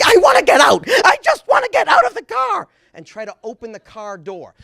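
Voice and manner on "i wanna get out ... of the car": very high, loud frantic scream; mom screaming. Retold or imitated. Mother screams in the car that she wants out, frantic high scream. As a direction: shriek it like you're desperate to escape. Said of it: "my mom would start screaming".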